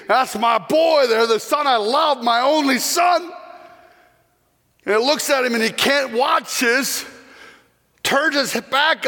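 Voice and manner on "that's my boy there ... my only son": weeping father. Acted. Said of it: "the father's just weeping".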